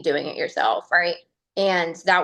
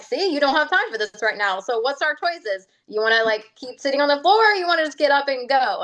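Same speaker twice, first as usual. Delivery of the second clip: coaxing self-talk; 6 semitones higher than usual. Retold or imitated. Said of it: "I would answer myself back".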